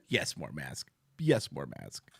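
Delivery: low, soft sarcastic reply; mocking contradiction. Answering Thomas's hope with a low sarcastic jab. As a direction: undercut him with a deadpan low retort.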